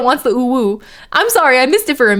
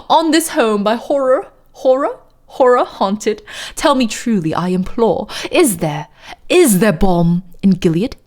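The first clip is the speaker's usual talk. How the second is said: theatrical poem reading in posh British; fake posh British accent. Narrator begging the raven for answers, fake posh British accent. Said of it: "my fake ass posh British accent".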